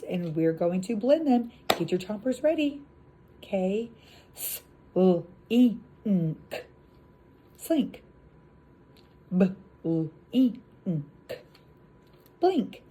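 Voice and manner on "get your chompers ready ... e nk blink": blending demo. Shown as a demonstration. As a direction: pull the sounds together for the kids. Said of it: "I'm gonna give you some sounds".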